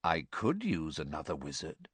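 low, soft, cold musing; softly. A narrator's reading. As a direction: consider it coolly under your voice. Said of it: "said the first voice softly".

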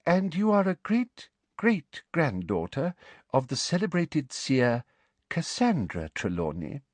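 high, slow, sugary official questioning; prim inquisitor voice. A narrator's reading. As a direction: ask slowly with a high prim voice.

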